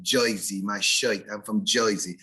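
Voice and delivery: New Jersey "oi" for "er"; old-school Jersey accent, "Joisey" and "shoit". Shown as a demonstration. Teacher showing an Eastern subdialect, Jersey accent. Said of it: "a subdialect might be when they say".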